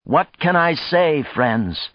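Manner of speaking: high, helpless appeal falling at the end; crying out. A narrator's reading. Stunned man addresses his expectant friends, crying out. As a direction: cry it out to the whole crowd. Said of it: "he cried".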